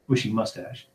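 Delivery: usual speaking voice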